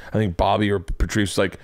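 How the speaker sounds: ordinary delivery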